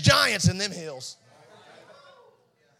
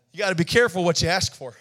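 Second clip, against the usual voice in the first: normal talking voice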